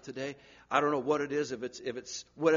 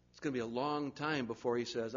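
ordinary talk, nothing marked

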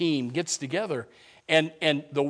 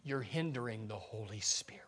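whisper; 7 semitones lower, 17 dB quieter than usual. Retold or imitated. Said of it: "he said".

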